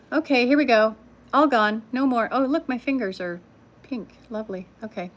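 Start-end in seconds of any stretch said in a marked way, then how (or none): none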